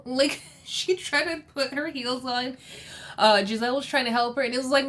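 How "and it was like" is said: ordinary delivery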